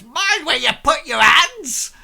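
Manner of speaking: high, loud indignant yell; indignant woman. Acted. Shoved woman scolds whoever pushed her, indignant yell. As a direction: shriek it like an offended woman. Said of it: "Like this".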